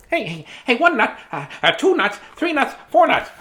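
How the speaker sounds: squirrel voice. Shown as a demonstration. Reader trying a squirrel voice for the book. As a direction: try out a chirpy squirrel voice. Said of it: "we need a voice".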